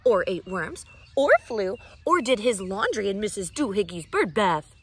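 loud scolding list. Acted. Mother listing his bad habits, loud and scolding. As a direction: keep scolding, item by item. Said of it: "she scolded".

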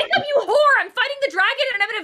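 high, loud mock outrage; playful yell. Real, not acted. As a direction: shout across the game at a friend. Yelling at friend mid-fight.

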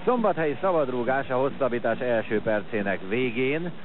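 ordinary talk, nothing marked

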